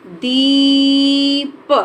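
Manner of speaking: दीप drawn out very slowly, syllable by syllable; slow teaching demo; slow syllabified word. Shown as a demonstration. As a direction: spell out दीप slowly for the class. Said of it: "देर तक बोलेंगे".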